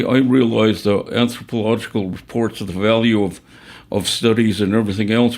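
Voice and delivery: usual speaking voice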